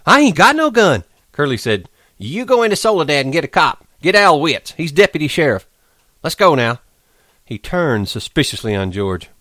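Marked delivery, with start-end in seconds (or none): 0.0-6.8 high, fast commanding voice; Curly sending a man for the cop, high and sharp; Curly barking orders. A narrator's reading. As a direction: order them about.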